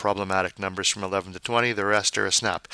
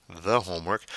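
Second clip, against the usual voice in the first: usual speaking voice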